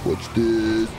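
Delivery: ordinary talk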